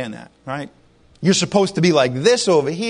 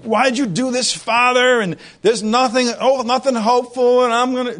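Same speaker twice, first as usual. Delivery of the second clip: freaking out; 5 semitones higher than usual. Pretend. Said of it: "he was freaking out and saying".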